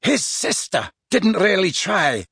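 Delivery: menacing. A narrator's reading. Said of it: "said McLaggen menacingly".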